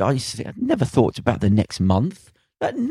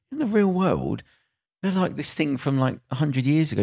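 up high and softer, talking down to him; higher mock lecture; 6 semitones higher, 5 dB quieter than usual. To a young gangster, a higher, softer scornful lecture. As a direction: set him straight, a bit softer.

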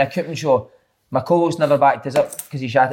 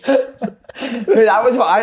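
laughing; 6 semitones higher, much slower than usual. Real, not acted. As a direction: still laughing as he starts. Laughing at phone number joke.

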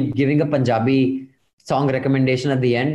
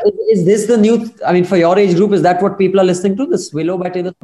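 much louder and higher, disbelieving; incredulous; 6 semitones higher, 7 dB louder, faster than usual. Real, not acted. Host asking the young guest about her music taste in loud disbelief. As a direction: burst out in amused disbelief.

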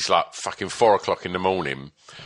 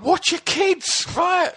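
mock TV presenter; much slower than usual. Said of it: "kinda like".

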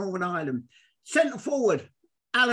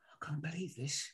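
awed whisper; 7 semitones lower, 15 dB quieter than usual. Retold or imitated. Awed inner thought at ballgame.

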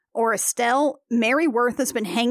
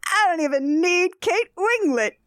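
put-on town drunk; 5 semitones higher than usual. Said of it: "how drunk people talk".

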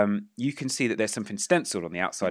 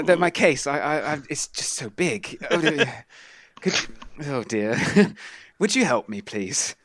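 put-on higher, fretful old man struggling with his case; flustered old man; 5 semitones higher than usual. GM as the old traveller asking a young man for help. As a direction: play a bothered old man who can't lift his bag. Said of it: "the old man with his luggage".

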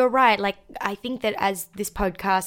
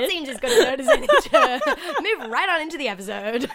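fast, laughing through it; 6 semitones higher, faster than usual. Real, not acted. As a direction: giggle through the line. Laughing wrap-up.

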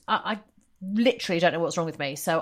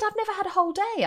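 quoting mum; 14 semitones higher, faster than usual. Retold or imitated. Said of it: "She said".

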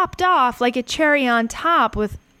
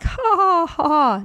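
high, head-voice mock laugh; twittering laugh; 7 semitones higher than usual. Shown as a demonstration. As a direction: titter high up in the throat. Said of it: "It's not a twittering laugh. He's not like".

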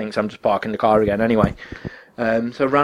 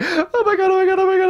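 much higher, giddy repeated squeal with a laugh; wild joy, pitched way up and a bit louder; ecstatic squeal; 3 dB louder than usual. Retold or imitated. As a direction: gush it over and over, beside herself. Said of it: "went ballistic".

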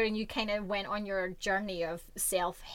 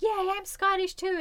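higher, louder keen Scottish too; mock enthusiasm, pitched up; eager tourist; 11 semitones higher, 6 dB louder than usual. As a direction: gush like a proud tourist. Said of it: "people who were like".

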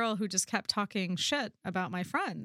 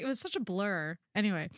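normal talking voice

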